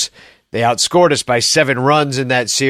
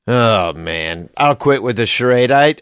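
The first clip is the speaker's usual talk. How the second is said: normal talking voice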